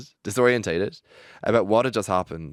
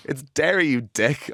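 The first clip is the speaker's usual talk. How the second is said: much higher, louder "It's Derry, you dick"; retold shout; 7 semitones higher, 5 dB louder than usual. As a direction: snap the correction at him, half laughing. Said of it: "I shouted".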